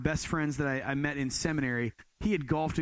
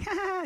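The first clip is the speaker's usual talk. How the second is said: mock laugh; faster than usual. Friend laughing it off.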